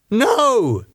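high, forceful shouted denial. A narrator's reading. David refusing Elizabeth's suggestion of Lydia, shouting. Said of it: "he shouted".

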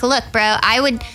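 ordinary talk, nothing marked

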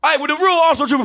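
high, loud hype shout; hyped shout. Real, not acted. Fan hyping up his club with a high shout. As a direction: yell it like a fan celebrating.

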